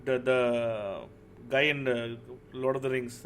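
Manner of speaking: ordinary talk, nothing marked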